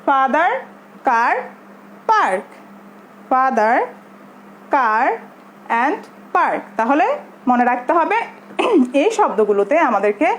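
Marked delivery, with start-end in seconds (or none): 0.1-6.6 "father", "car", "park" spaced out, each "ah" held long; drawn-out "ah". Shown as a demonstration. Teacher saying it with learners, "father", "car", "park" with long "ah". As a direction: lead a repeat drill, stretching the "ah" in "father", "car", "park". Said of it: "একটু আমাদের টেনে পড়তে হবে".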